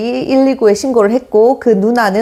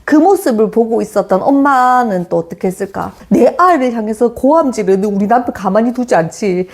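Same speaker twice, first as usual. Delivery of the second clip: normal talking voice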